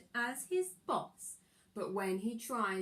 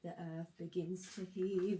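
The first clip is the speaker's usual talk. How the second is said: usual speaking voice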